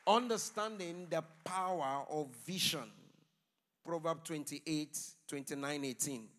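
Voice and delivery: loud sermon announcement; big, projected pulpit delivery; forceful preaching. Real, not acted. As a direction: proclaim the scripture loudly, stretching the key words. Announces sermon title and verse loudly.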